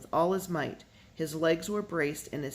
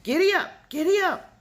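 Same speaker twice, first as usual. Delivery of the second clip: very high, loud repeated yells at the oxen; desperate shouting; 14 semitones higher, 9 dB louder than usual. A narrator's reading. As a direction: holler over and over to make them pull. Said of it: "He shouted".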